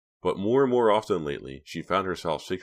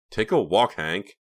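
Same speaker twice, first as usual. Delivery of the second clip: raised, emphatic send-off, dropping on the name; dismissive order; 4 semitones higher, 5 dB louder, slower than usual. A narrator's reading. Reva pushes Hank's door open, dismissive. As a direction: order him out curtly.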